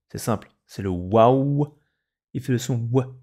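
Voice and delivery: ordinary talk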